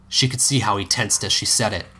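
ordinary delivery, nothing marked

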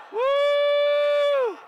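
high, loud "woo"; real revival-meeting holler; exultant whoop. Real, not acted. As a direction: let out a piercing praise whoop. Preacher whoops in worship.

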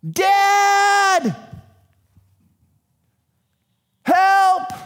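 put-on high, loud child's yell; a bullied kid calling for help; child shouting for dad. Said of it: "the kid on the playground".

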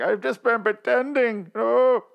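high, slow, put-on despairing king; mopey king voice. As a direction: whine it like a defeated monarch. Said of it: "He's like".

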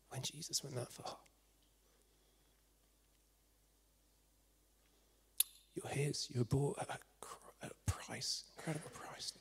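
whispered, reverent appeal with long silences; hushed preaching. Real, not acted. Preacher tells listeners they were bought at a price, hushed. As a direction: drop almost to a whisper and let it sink in.

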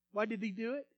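ordinary talk

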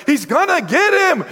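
high excited cry from the crowd; excited yelling. Retold or imitated. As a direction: cheer him on, way up high. Crowd cheering on the chase.

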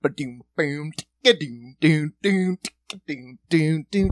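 vocalised reggae drum rhythm; beatbox a lazy island groove; mock island drum sounds; mouth drum beat. Imitating island music beat.

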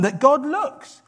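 usual speaking voice

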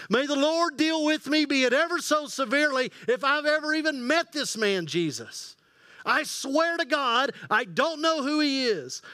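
high, heated oath; frantic cursing denial. Acted. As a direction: swear desperately you never met him. Peter curses himself denying Jesus.

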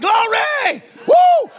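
very high loud whoop; shouting glory. Retold or imitated. Preacher celebrating the bargain, loud joyful whoop. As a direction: holler glory with a woo. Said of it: "me and Ted are both shouting".

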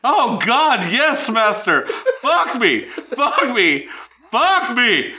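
mock moaning. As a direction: ham up the passion, laughing. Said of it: "I'll be the woman".

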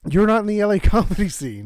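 laughing teasing retort. Real, not acted. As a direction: tease him through a laugh.

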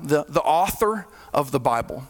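ordinary talk, nothing marked

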